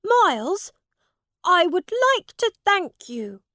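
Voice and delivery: put-on high, loud young prince voice; the prince thanking his rescuer; lofty child royal gratitude; grateful boy king. A narrator's reading. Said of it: "said Edward".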